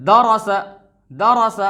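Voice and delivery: दरस crisp and short; firm, brisk दरस; short vowels, read quickly. Shown as a demonstration. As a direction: recite दरस quickly, no stretching. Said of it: "बिल्कुल कैसे?".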